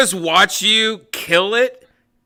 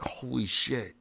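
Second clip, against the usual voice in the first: hushed amazement; 5 semitones lower, 13 dB quieter than usual. Retold or imitated. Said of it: "you're just like".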